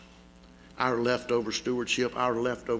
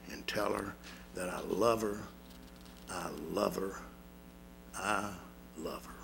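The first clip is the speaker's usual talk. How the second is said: dying whisper, much softer and lower; fading last words; 7 semitones lower, 11 dB quieter than usual. A narrator's reading. Dying boy sends love to his mother, fading to a hush. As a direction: let each I love her fade away.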